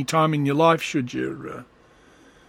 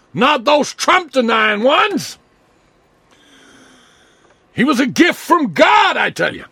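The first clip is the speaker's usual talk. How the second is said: high, loud hammed-up Trump devotee; mock conservative praising Trump; mock MAGA fan; 8 semitones higher, 4 dB louder than usual. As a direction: preach Trump's glory like a zealot.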